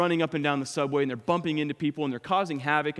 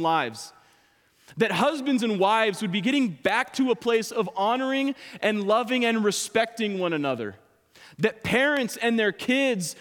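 higher, insistent preacher voice, pressing each question; preaching at full force; 7 semitones higher, 4 dB louder than usual. Acted. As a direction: drive the point home to the congregation.

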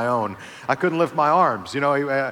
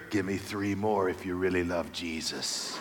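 whispered taunt; 8 semitones lower, 9 dB quieter than usual. Retold or imitated. Workout partner to lifter at max, lower, softer whispered taunt. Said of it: "whisper in my ear".

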